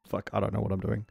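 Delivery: usual speaking voice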